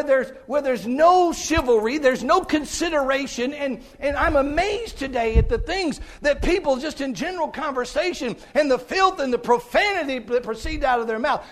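high, loud pulpit exhortation; impassioned, forceful sermon; full-force preaching. Real, not acted.

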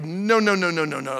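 ordinary delivery

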